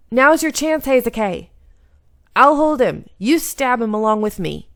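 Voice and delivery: high, loud urging; urgent shout. Acted. Chizuru grapples Amagiri and shouts at Heisuke to stab him. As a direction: yell at him to strike now. Heroine urges Heisuke while holding enemy.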